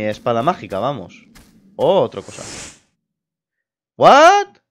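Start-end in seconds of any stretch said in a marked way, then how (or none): 4.0-4.6 very high, loud shriek of disbelief; shocked outburst. Real, not acted. Shocked by a puzzle appearing.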